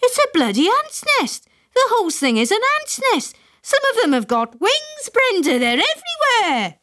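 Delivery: high, alarmed dad voice; panicking over the ants; father, alarmed. A narrator's reading. Father to Brenda about the nest: high, alarmed.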